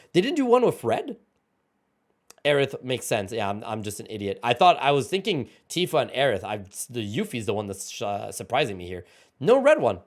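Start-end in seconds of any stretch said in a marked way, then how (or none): 0.1-1.2 surprised. Real, not acted. Missing favourite character card. 9.4-10.0 surprised. Real, not acted. Missing favourite character card.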